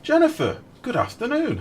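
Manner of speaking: high, loud cheery hello; excited greeting. Real, not acted. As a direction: call out the greeting brightly. Greeting a viewer in stream.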